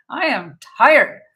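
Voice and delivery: tired complaint. Retold or imitated. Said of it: "And I was like".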